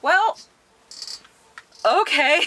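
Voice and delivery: high-pitched, loud, drawn-apart doubtful reply; hesitant reaction. Retold or imitated. Listeners react to the demon-summoning plan, high and hesitant. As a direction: awkwardly humour them, way up high.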